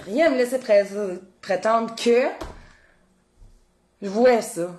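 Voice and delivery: ordinary delivery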